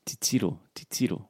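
soft repeat of "titiro", second syllable stressed; "titiro" softly, leaning on its middle. Shown as a demonstration. Learner echoing the teacher's stress, middle-stressed "titiro". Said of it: "The stress is on the middle one".

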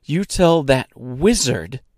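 worked-up rant, short of a real scream; furious tirade. Acted. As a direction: list each ordeal with mounting outrage. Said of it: "screaming at the top of her lungs".

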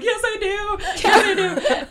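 high-pitched, half-laughing retold insistence; frantic insistence. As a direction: insist in a squeaky, worked-up voice. Retold tearful insistence on paying.

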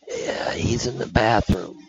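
slow, hushed retold whisper; imitating a sneaky whisper. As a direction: whisper the cover story. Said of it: "all this whispering going".

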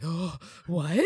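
lightly voiced startled oh what; mock stunned double take; imitating Villanelle. Villanelle hearing Eve lives, mock shocked. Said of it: "does that equilibrium, like".

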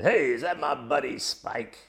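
stretched-out, put-on boom without real volume; mock booming voice. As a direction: imitate a buddy's big voice calling slowly across a room. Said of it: "a big voice booms out".

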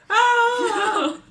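high piercing shriek; shrill scream. Retold or imitated. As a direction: scream out in fright. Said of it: "Be like".

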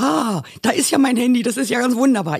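high, delighted exclamation; overjoyed. Retold or imitated. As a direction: cry out in happy relief at finding the phone. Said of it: "Ich war so glücklich".

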